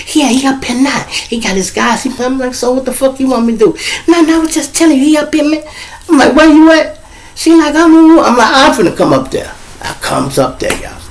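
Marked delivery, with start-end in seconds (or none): 0.1-9.5 much higher, breathless excitement; excited girl voice. Retold or imitated. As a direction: squeal it out, pitched way up. Excited phone exchange.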